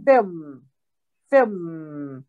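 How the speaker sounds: "film" with lips closed on a lingering mmm; closed-lip final sound. Shown as a demonstration. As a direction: end "film" on a long hummed M. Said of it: "闭唇的音".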